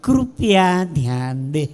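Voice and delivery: low, slow, drawn-out platform announcement; station announcer. Acted. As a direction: stretch each word like a railway announcement. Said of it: "એનું alarm સાંભળજો".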